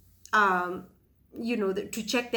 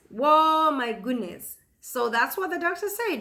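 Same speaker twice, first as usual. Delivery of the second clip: normal talking voice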